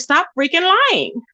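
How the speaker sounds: ordinary delivery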